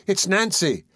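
high-pitched, mild exclamation; astonished boy. A narrator's reading. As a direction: recognise her with a high start. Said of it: "exclaimed Oliver".

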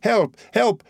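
high-pitched calls of alarm; short, high alarmed cries; cry for help. A narrator's reading. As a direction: call out for rescue, pitched well up. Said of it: "cried Mademoiselle d'Armilly".

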